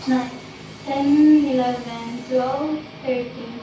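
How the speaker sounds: soft, drawn-out counting; slow timed count. Real, not acted. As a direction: count slowly along with a long exhale. Counting length of student's exhale. (mabagal at mahinang pagbibilang)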